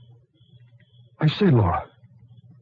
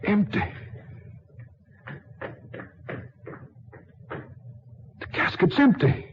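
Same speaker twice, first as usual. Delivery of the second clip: high, stunned gasp of disbelief; shocked discovery; 8 semitones higher, 5 dB quieter than usual. Acted. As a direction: realise the coffin is empty, breathless. Discovering the empty casket.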